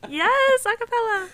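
very high, loud sung-out whoop; excited cheer. Real, not acted. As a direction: cheer the singer on at full pitch. Cheering the other's sung riff.

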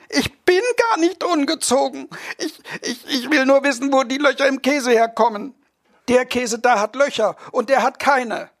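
put-on high, pleading child's voice that yelps at the shove; a small boy begging to know; whiny little boy. Boy nags his parents at the dinner table, high and whiny.

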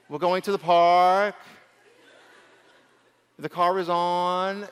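slow Californian drawl with a rising tail; mock the Californian sentence ending, slowly; lightly exaggerated California accent. Shown as a demonstration. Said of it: "the California accent".

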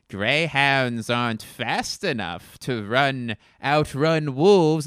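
a cartoon U-boat captain bragging with a wolf pun; silly fake German accent. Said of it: "he keeps calling in with this awful voice".

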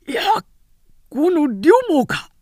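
high, loud cry of surprise; startled shout. Acted. General startled by a spear attack, surprised shout. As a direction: recoil as the enemy appears.

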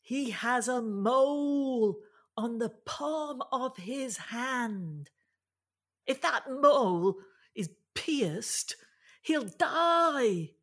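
high, drawn-out calling voice; shouting over waves. Acted. Finn tells the crew the giant's weak spot, calling out. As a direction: call it out across roaring water, word by word. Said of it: "Shout louder".

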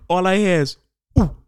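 loud, high crowd "Ooh"; be the whole audience going ooh; hammed-up crowd gasp "Ooh". Repeats crowd ooh louder.

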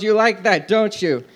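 ordinary talk

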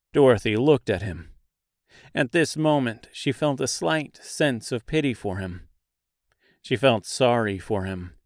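ordinary delivery, nothing marked

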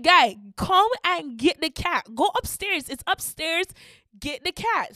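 high, loud, frantic orders; retold panicky impatience; exasperated urging. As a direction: push the frozen helper into moving. Acted exasperated orders to frozen helper.

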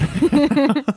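squealing laugh after a mock voice; high laugh. Real, not acted.

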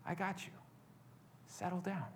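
very soft, hushed fatherly reassurance; retold reassuring whisper. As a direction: settle a frightened child, barely audible, with long pauses. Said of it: "I hear something of a whisper".

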